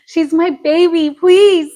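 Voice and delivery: mock-pouty doting mom; playful pleading. Mother playfully defends her grown daughter.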